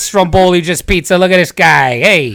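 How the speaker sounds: mock-offended New Jersey guy, starting high; exaggerated New Jersey accent. Host dismissing co-host's stromboli question, mock New Jersey accent. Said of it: "a New Jersey twang in your voice".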